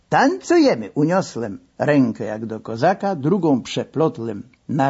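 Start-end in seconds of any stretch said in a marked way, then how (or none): 0.1-0.9 high, playful Russian-tinged call; mock Cossack call. A narrator's reading. Narrator answers the masseur in a mock Cossack call. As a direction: sing out the dance invitation.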